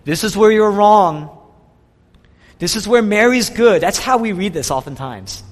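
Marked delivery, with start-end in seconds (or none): 0.0-3.8 loud, scolding rebuke of Martha; a hammed-up stern scolding; preacher voicing Jesus harshly scolding Martha; mock rebuke. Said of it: "rebuke them".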